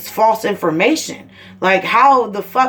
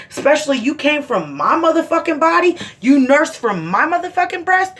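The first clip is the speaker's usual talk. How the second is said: higher, heated indignation; indignant anger; 7 semitones higher than usual. Real, not acted. Mother furious about her son's disrespect, heated. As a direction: let the outrage climb.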